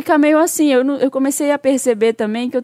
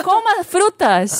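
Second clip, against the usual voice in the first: slow, higher mock preachy advice; mocking generic advice; 6 semitones higher, much slower than usual. Mocking a useless tip from family, drawn out and preachy. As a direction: drag it out like a smug tip.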